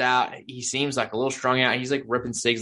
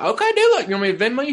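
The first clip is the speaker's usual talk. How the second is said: mock eager reply; 11 semitones higher, 4 dB louder, faster than usual. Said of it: "And I was like".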